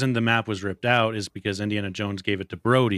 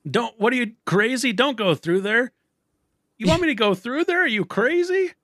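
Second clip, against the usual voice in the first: panicked high-pitched cry; 14 semitones higher than usual. Retold or imitated. Panicked boat chase quote acted out.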